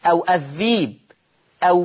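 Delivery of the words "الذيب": long unbroken ee in الذيب; hamza turned to ya. Shown as a demonstration. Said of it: "تبدل ياء، فتصير بيس".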